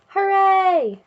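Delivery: high, loud team cheer; cheering shout. Acted. Team cheering after the coach's pep talk, loud shout. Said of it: "screamed the dream team".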